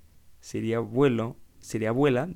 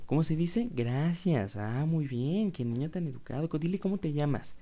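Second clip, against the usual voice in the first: put-on much higher, sing-song baby-talk adult; talking to a toddler; 6 semitones higher than usual. Grown-up coaxing a little kid to answer, high sing-song. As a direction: coo at a small child like a proud grown-up. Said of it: "Es como cuando traes a los niños chiquitos".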